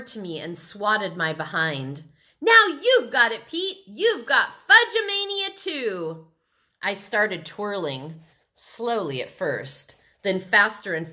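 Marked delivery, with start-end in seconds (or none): 2.4-6.3 put-on high, loud, gleeful kid; excited calling. A narrator's reading. Fudge tags his brother Peter, excited high shouting. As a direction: cry it out as you tag someone.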